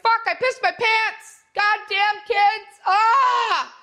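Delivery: high, loud and strained, slow shout; a mother jogging, shrieking in dismay at her own bladder; mock panicked runner. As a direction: yell it like you just wet yourself mid-run. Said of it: "you're like".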